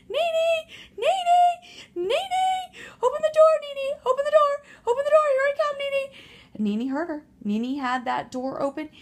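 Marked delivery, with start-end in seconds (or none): 0.1-6.1 high, loud frantic screaming for help. Acted. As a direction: scream for Nene to open the door.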